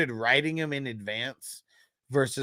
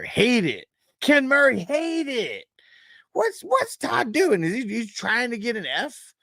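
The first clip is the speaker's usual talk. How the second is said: higher and louder with each name; worked-up rant; 12 semitones higher, 7 dB louder than usual. Real, not acted. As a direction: build to exasperated disbelief. Ranking players, getting worked up.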